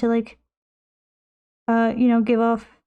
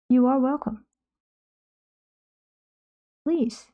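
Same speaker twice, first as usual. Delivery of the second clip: welcoming host voice; 3 semitones higher than usual. Pretend. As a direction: greet the guest like a gracious host. Greeting a viewer in character.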